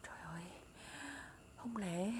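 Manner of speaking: very soft whisper to oneself; hushed wonder. Acted. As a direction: breathe it out in disbelief. Left alone, wondering to herself. (thì thầm tự hỏi)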